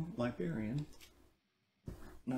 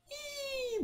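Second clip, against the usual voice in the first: high squealing scream, softer; children's squeal; 6 dB quieter than usual. A narrator's reading. Children scared by the roaring bear, high squeal. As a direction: shriek like startled little kids. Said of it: "the children screamed".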